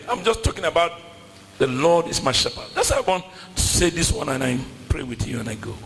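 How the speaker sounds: ordinary talk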